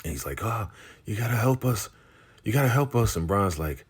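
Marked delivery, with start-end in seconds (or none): 0.4-3.1 high pleading voice; beg, pitched up; imitating a trapped man begging, higher; mock pleading.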